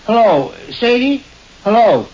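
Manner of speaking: high-pitched call for his wife. Acted. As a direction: sing out to find your wife. Calling for wife from front door.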